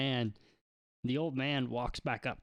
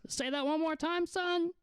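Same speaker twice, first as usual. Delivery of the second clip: put-on high-pitched, deaf old man; high old shopkeeper voice; hard-of-hearing old man. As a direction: ask like an old fellow straining to hear. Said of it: "and he goes".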